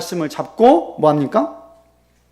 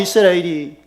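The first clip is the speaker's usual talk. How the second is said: ordinary talk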